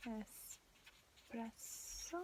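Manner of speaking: very slow, soft "espresso" in separated syllables; spelled-out slowly. Shown as a demonstration. Teacher showing the spelling, very slow soft syllables in "espresso". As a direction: break "espresso" into es, pres, so. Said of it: "spelled differently".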